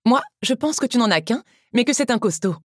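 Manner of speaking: high, lively woman's dialogue voice; Teresa chiming in. Acted. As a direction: chime in teasingly, pitched well up.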